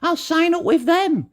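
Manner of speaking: high, naive put-on voice; mocking, credulous little voice; mock gullible viewer. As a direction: imitate an easily won-over customer, pitch climbing. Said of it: "you see this advert and you're like".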